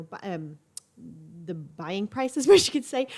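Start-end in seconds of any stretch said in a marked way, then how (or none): 2.4-3.1 laughing. Real, not acted. Laughing at own wording.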